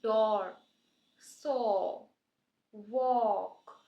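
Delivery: डोर सो वॉक with a long o, वॉक drawn very slow. Shown as a demonstration. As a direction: stretch the o in वॉक. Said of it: "long ओ के".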